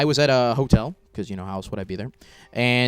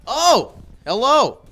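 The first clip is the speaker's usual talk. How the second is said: awkward hello; 10 semitones higher, 8 dB louder, much slower than usual. Acted. Comic awkward stage greeting.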